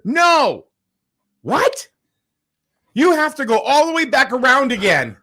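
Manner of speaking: high, loud mock refusal; curt worker. Host mimicking the worker refusing his order, high and loud. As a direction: bark the refusal like the rude worker. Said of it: "And I said".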